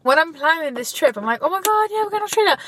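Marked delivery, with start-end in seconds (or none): 1.4-2.6 high-pitched mock giddy thrill; excited squeal, acted out. Acts out own excitement about trip.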